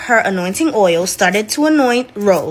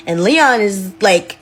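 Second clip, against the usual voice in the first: usual speaking voice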